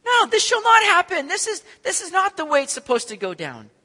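high, loud complaint; a high, loud, upset protest; upset at the Lord. Retold or imitated. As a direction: protest to God. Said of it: "How often do we get upset at the Lord".

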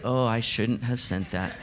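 a quiet oh-no realisation; regretful. Retold or imitated. Writer who just hit send, softly dismayed.

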